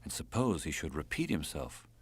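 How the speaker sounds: ordinary delivery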